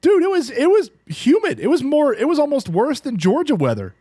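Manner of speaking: outraged at the humidity; exasperated exclaim. Real, not acted. Friend complaining about Minnesota humidity, high exasperated exclaim.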